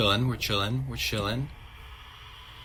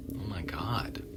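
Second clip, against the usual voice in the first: breathless whispered oh my God; whispered shock; 7 dB quieter, slower than usual. Real, not acted. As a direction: hush it out in shock. Shocked by game scene.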